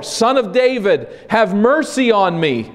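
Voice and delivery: loud, high desperate cry. Acted. Blind man shouting over the rebuking crowd to Jesus, loud desperate plea. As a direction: call out over the crowd, pleading. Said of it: "But he shouted all the more".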